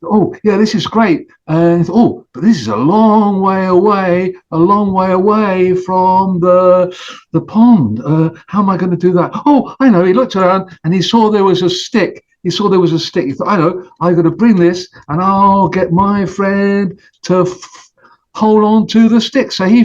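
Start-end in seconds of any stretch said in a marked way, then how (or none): 0.0-10.0 goose musing. Acted. Goose thinks aloud. 13.5-19.5 goose planning. Acted. Goose's thought.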